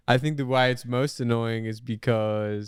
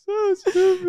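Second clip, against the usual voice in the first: squealing laugh through the words; high, slow laughing cry; 5 dB louder, slower than usual. Real, not acted. Laughing at the joke.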